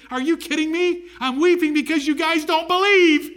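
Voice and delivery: high, loud, incredulous outburst; exasperated correction. Acted. Preacher voices Jesus correcting the mourners, exasperated. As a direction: burst out high, building to loud. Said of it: "Jesus corrected them by saying".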